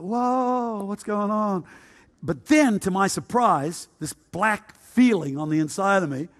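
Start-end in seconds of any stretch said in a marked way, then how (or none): 0.0-1.6 high, drawn-out hippie "whoa"; bewildered, raised pitch at normal volume; startled. Retold or imitated. Young hippie taken aback by yelling elders, startled.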